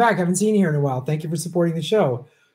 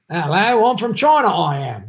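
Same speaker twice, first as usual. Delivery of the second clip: badly faked Chinese accent, pitched up; 4 semitones higher than usual. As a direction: botch a Chinese accent so it drifts towards Dickensian English. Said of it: "That's my Chinese accent".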